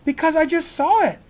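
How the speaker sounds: high, loud excited shout. Acted. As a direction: shout it back over your shoulder. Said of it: "Annie shouted".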